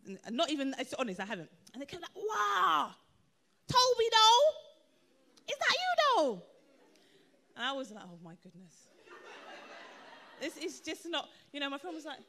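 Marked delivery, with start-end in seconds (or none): 2.2-6.4 high, loud imitation of friends hailing someone; excited street greeting. Street friends hail her in surprise. 8.0-8.7 very low, hushed mock dismay; embarrassed aside. Her embarrassed reaction to being hailed.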